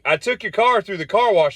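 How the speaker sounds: high, exasperated report. Retold or imitated. Telling owner about the leaky convertible, high exasperated. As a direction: squawk it out, exasperated. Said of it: "I'm like".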